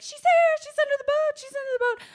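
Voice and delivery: high, loud shout of discovery; excited calling out. Retold or imitated. Rescuers spotting her under the boat: high excited shouting.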